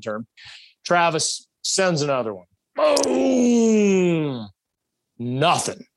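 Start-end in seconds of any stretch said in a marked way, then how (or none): 2.7-4.5 a high, loud boom drawn out for the rifle shot; imitated gunshot. Telling how Travis sends another round, a loud mock boom. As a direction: shout the second shot as it happens.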